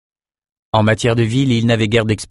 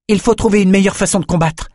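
put-on higher girlish voice, urgent; Ambre's voice; 10 semitones higher than usual. A narrator's reading. Ambre arriving to urge her friends, higher urgent voice. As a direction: run in and urge a better plan. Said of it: "dit Ambre en accourant".

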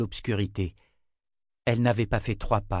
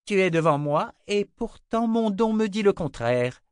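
puzzled sorceress; 10 semitones higher, 4 dB louder than usual. Acted. Sister puzzled by Jensen.

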